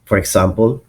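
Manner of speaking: ordinary talk, nothing marked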